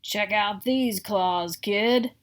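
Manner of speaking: slow, lightly rasped monster voice; rasping monster. Acted. The third monster shows the child his claws, rasping. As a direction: rasp it out like a proud beast. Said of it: "a third voice from under the bed rasped".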